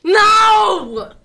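sudden yelled no; shout. Real, not acted. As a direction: yell it out in protest. Streamer yells at chat.

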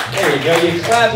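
ordinary delivery

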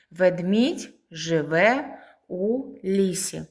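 slow, separated words for pupils; teacher spacing out each word. Shown as a demonstration. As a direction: dictate it slowly, a pause between words. Splitting sentence into words for pupils.

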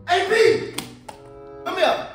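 loud, high summons; calling out. Acted. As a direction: call your little brother over, annoyed. Calling his little brother over.

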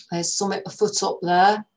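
put-on old northern English man warning the girls; northern English accent. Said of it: "He spoke slowly and with an accent from further north".

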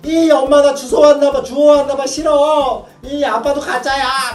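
mock childish whine, slowing at the end; whining child tantrum. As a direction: play a child sure they were adopted, wailing. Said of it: "막 이럴 때가 있잖아요".

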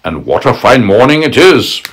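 crafty wolf savoring his words. Acted. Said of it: "he said".

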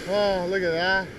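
usual speaking voice

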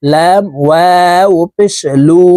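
usual speaking voice

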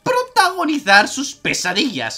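high, slow clown voice; silly clown. Acted. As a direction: drawl it out like a clown. Voicing the game's clown teacher.

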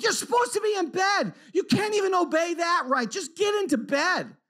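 high-pitched shouting parent; re-enacted dad losing patience; exasperated scolding. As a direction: yell up the stairs, fed up. Exasperated father yelling at child upstairs.

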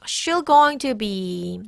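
every word of "going to be" slowly separated; very slow, unreduced "going to be"; slow full pronunciation. Shown as a demonstration. Teacher modelling the phrase for learners, slow full "going to be". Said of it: "Notice how this is pronounced".

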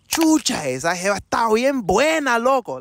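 high, loud excited street exclamation. Retold or imitated. Friend on the street exclaims loudly, high and excited. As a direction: exclaim it like you just saw something amazing. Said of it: "You could be like".

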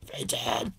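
Fenton! breathed out as a whisper, no voice; whispered call. Pretend. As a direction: mouth the dog's name under your breath. Trying the name while drawing.

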